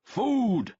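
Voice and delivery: loud, high bellow; tearing form. A narrator's reading. Host roars for food in tearing form. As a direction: roar it out with gusto. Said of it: "Aurelian was in tearing form".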